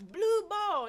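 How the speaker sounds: put-on loud, high referee's call in a Baltimore accent. Referee calls out the team color, Baltimore accent shouted. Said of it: "I done picked up the Baltimore accent".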